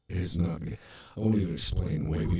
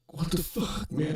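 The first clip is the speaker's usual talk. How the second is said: high-pitched mock disbelief; retold startled reaction; 11 semitones higher than usual. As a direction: act out a startled cellmate, pitched way up.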